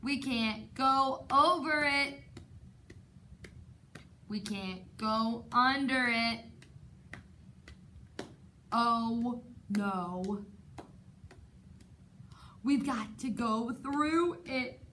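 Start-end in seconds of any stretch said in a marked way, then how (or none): none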